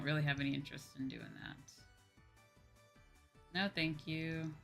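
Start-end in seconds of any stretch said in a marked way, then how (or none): none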